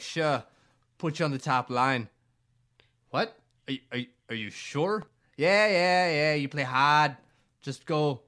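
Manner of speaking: hammed-up coach waving him on; loud, offhand imitation of the coach; mock coach. Coach voiced in a retold scene.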